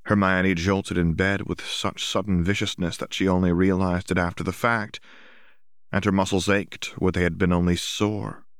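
ordinary delivery

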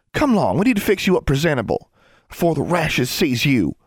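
high-pitched bossy sister; high, bustling country scolding; fussing. A narrator's reading. Older sisters fuss over the younger.

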